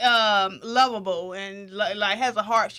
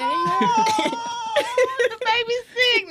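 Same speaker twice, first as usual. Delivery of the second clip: high, drawn-out pitying coo; mock cooing; 12 semitones higher, 4 dB louder, much slower than usual. Mother fusses over her sick child, high exaggerated coo. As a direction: imitate fussing over a poorly child.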